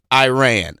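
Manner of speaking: "Iran" as a loud eye-RAN; imitated loud "Iran", eye-RAN; American pronunciation of Iran. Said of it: "and then she said".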